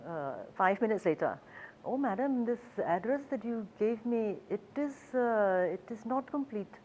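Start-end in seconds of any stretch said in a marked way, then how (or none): 1.8-6.7 put-on the driver admitting the address is incomplete, in a Hindi accent; lightly imitated Hindi accent. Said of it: "my attempt at a Hindi accent".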